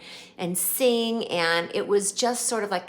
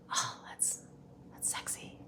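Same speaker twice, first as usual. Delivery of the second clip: imitating [name]'s whisper; 13 dB quieter than usual.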